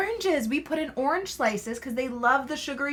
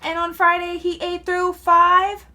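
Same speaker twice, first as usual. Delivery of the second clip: put-on lively, louder storybook read with pauses for the child; reading to children; 7 semitones higher, 7 dB louder, slower than usual. Mother prompting her child through the food list, animated storybook voice. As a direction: leave gaps for the child to name the food. Mom reads picture book to child.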